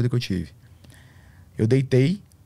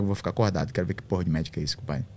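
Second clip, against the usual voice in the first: low, quick mutter under breath; retold self-talk, muttered lower and softer; muttered resolve; 6 semitones lower, 9 dB quieter, faster than usual. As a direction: mutter it to yourself, stubborn. Quoting his own resolve.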